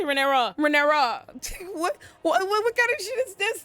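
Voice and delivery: high, disbelieving cry; aghast outcry. Retold or imitated. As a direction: shriek it out in disbelief. Aghast outburst at Rhaenyra.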